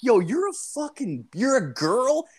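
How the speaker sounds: high, incredulous rise; acted disbelief. Storyteller confronting the unhooded kid, acted disbelief. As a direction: play up the astonishment, pitch leaping. Acting out his shock at reveal.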